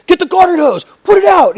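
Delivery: high-pitched, loud panicked command; frantic yelled orders. Retold or imitated. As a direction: scream instructions down the phone. Retold frantic orders during fire.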